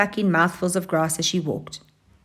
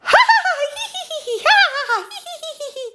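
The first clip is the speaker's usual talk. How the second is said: high cackling hyena laugh. Acted. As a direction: let out a shrill cackle instead of a roar. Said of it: "loudest sound she could make".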